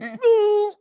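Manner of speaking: high, loud comic wail; high-pitched mock cry of tension; hammed-up strained howl. Daddy Cop squeezing his mug till it breaks, comic howl.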